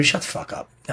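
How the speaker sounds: voiceless hushed put-down; whispered dismissal. Retold or imitated. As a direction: breathe it out as a quiet hiss of contempt. Retelling dismissing well-off advice-giver.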